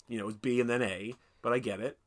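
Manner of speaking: ordinary talk, nothing marked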